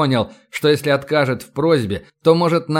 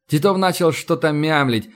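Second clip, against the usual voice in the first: ordinary delivery, nothing marked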